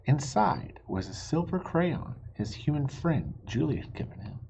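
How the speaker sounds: usual speaking voice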